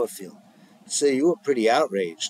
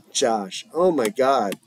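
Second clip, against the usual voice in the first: ordinary delivery, nothing marked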